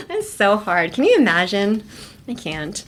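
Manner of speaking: normal talking voice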